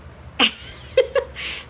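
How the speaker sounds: high, breath-led laugh. Real, not acted. Live host amused by a viewer, laughing. Chuckling during her live chat.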